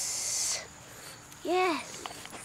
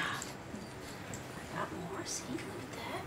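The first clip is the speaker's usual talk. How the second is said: whispering to dog; 3 semitones lower, 15 dB quieter, slower than usual. Real, not acted. Quiet to dog.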